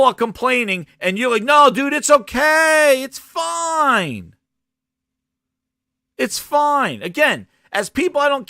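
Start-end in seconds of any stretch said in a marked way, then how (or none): 1.4-7.0 high, drawn-out "it's all fine" placating; exaggerated high-pitched soothing of a buddy; mock reassurance. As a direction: imitate a fan brushing off complaints, pitched way up. Voices a fan dismissing complaints.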